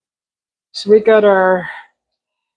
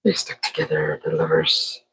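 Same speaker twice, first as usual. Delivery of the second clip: much lower, mock-dramatic deep voice; 8 dB quieter than usual. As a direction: drop into a big booming movie-trailer low. Voicing the chip lovers.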